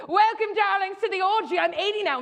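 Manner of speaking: high, loud grand hostess greeting her guests; grand old lady. Retold or imitated. Old hostess welcoming guests to her orgy, grandly. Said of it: "'cause she literally talked like that".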